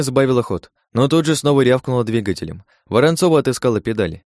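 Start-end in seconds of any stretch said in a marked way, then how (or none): none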